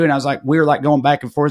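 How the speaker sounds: ordinary talk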